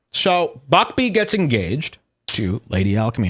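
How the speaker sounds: usual speaking voice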